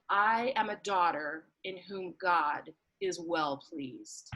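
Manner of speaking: normal talking voice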